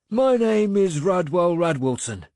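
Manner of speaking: put-on elderly man naming himself; proud old farmer. A narrator's reading. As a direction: announce your name with pride.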